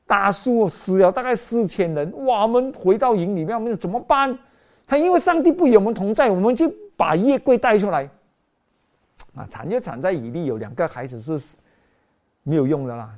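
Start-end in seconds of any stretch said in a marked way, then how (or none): 2.2-8.1 alarmed soldiers. Acted. Israelites panic after defeat.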